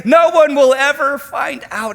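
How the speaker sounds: high, loud, eager reassurance; loud tempting voice of sin. Acted. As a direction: call it out like a cheerful tempter. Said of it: "it says".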